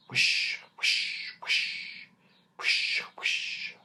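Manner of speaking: soft, breathy rustling whisper, fading away; whispering trees. A narrator's reading. The trees whispering to one another, hushed rustle. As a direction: breathe it like leaves in the wind. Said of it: "said the trees in the wood".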